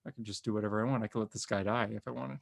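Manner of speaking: soft, understated threat; imitating cold musing. Tony dropping a villain from the air, quietly musing. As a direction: voice a private dark thought, softly.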